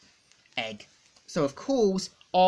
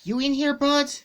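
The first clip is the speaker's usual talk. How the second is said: much higher, calling into the dark; Sonic calling out; 9 semitones higher, 4 dB louder than usual. Acted. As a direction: call out searching for your buddy. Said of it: "we see him saying".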